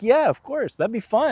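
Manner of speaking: high, loud, enthusiastic yes; eager agreement. Acted. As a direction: act out jumping at the invitation. Acting out an eager hypothetical yes.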